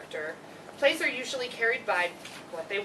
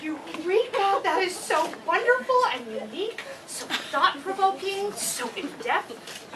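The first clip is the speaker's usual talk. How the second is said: high, loud over-the-top delight, slowing at the end; hammed-up teacher rapture; gushing praise; 9 semitones higher, 4 dB louder than usual. Teacher gushes over student's play.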